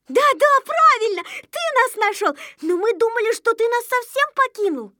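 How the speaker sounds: high-pitched eager chorus character; excited little ones. Acted. Little ones excitedly greet lost leader. (высоким голоском, радостно соглашаясь)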